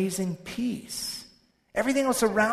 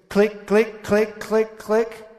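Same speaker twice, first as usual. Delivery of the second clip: imitating clicks; 3 semitones higher, 7 dB louder, much slower than usual. Imitating ride noise.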